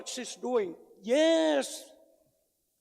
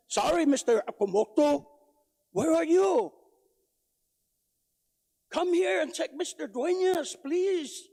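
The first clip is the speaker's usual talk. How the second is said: loud, higher call across the room; raised loud, higher pleading call; 4 semitones higher, 6 dB louder than usual. Real, not acted. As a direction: summon him loudly, as if he's far back. Calling out to absent doctor.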